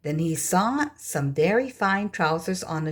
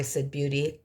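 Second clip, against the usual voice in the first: ordinary delivery, nothing marked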